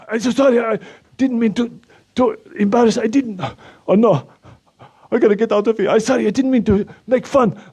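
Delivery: put-on high, panicky Slovak-accented apology; Slovak-accented man backpedalling in embarrassment, voice up high; Slovak accent, flustered. Anton apologises to America in a high, flustered Slovak accent.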